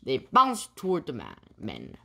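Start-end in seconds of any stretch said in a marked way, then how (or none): none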